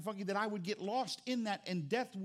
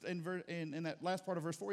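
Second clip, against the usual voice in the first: ordinary talk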